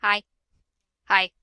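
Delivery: ordinary delivery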